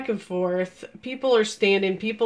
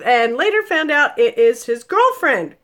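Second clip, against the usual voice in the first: ordinary talk, nothing marked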